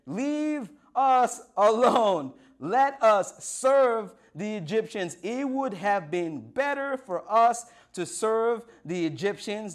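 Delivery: loud, slow, hammered-out protest; hammed-up panicked reproach; freaking-out Israelites. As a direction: wail the complaint at full voice, dragging words. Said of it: "they're freaking out".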